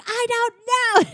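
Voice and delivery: very high, drawn-out mock wail; mock full panic. Linguini cracking under the staff's questions, hammed-up panicked wail. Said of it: "Linguini's freaking out".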